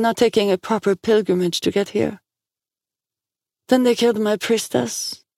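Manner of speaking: high, even, solemn prophetess; far-away oracle. A narrator's reading.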